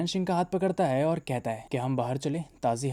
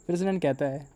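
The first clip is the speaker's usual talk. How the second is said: usual speaking voice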